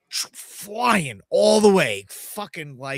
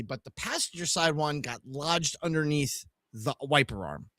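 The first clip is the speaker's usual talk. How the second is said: usual speaking voice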